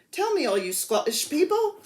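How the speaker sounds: bad English accent. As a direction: attempt a British accent and miss. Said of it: "that was a bad English accent".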